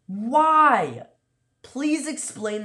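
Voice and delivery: high, loud disbelieving why; exasperated yell. Real, not acted. Yelling at the opponent's odd play, loud. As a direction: shout it at the screen. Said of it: "I was yelling".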